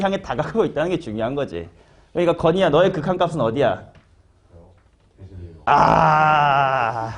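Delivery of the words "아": drawn-out, steady "aah"; hammed-up wail held long; long mock groan. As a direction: let out one long exaggerated groan. Long groan after teasing a student.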